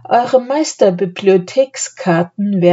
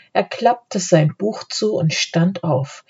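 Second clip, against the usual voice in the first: ordinary delivery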